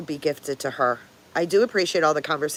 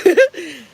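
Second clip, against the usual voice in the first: high, loud burst of laughter; laughing at the kinfolk shirts; 13 semitones higher, 8 dB louder than usual. Real, not acted.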